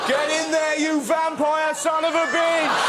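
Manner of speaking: slow, drawn-out high shout; mock cheering shout. Cheering on a vampire at his feast, high drawn-out shout. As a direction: roar him on like a triumphant fan.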